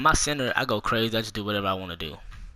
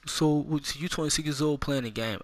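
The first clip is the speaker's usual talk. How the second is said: higher, put-on whiny kid taunting; mocking kid voice; 6 semitones higher than usual. Young online teammate taunting the adult player, mocking high voice. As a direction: sneer it like a bratty teen gamer. Mimicking kids taunting his age.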